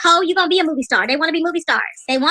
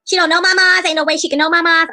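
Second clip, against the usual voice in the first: normal talking voice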